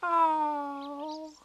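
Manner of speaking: high, drawn-out little sigh of disappointment; rather sad. A narrator's reading. Roo told no by Tigger, rather sad. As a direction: let the squeak sink, deflated. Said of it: "said Roo rather sadly".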